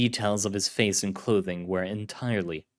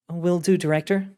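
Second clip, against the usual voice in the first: female agent voice; 8 semitones higher, 3 dB louder than usual. Acted. Carlotta voiced.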